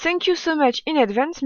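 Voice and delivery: French-accented English sign-off; English read out with a French accent. Real, not acted. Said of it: "Désolée pour mon accent, je sais qu'il est très mauvais".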